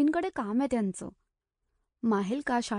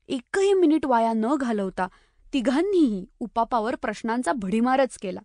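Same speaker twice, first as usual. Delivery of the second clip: usual speaking voice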